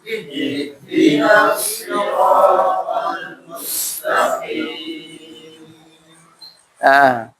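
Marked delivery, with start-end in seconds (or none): 0.0-5.6 slow, chanted tajwid recitation; Quran recitation. Shown as a demonstration. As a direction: recite the verse slowly, melodically. Recitation with music.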